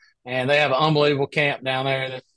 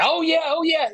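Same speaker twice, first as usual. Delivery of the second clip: imitating boastful stranger; 12 semitones higher than usual.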